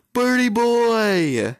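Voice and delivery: slow, high, loud drawn-out wail; wailing name. Real, not acted. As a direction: cry the name out long in grief. Bird died, calling out.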